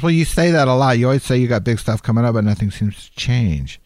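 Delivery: mock hassling, a hint of a complaining wife. Wife complains to podcast host about his promises, lightly mimicked hassling. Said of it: "my wife was hassling me".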